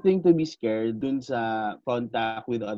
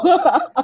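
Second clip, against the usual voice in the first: sudden high burst of laughter; 5 dB louder than usual. Real, not acted. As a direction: squeal with laughter. Laughing at joke. (matinis na tawa)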